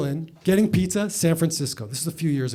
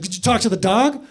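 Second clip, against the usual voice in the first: incredulous; 7 semitones higher, 5 dB louder than usual. Retold or imitated. Mom's absurd question.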